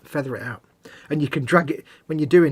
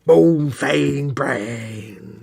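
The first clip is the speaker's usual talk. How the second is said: drawn-out name; 6 dB louder, much slower than usual. Pretend. Drawn-out playful paint name.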